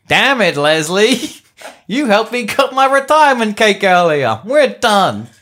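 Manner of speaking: hammed-up indignant outburst; angry refusal. Retired pilot turning down his commander, angry refusal.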